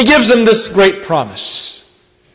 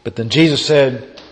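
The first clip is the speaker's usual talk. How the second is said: ordinary talk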